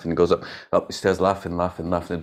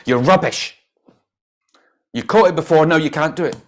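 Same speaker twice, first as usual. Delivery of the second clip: loud, higher-pitched acted berating; harsh scolding; 8 semitones higher, 7 dB louder than usual. Parent berating a child who dropped the ball, harsh. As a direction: tear into the child in mock outrage.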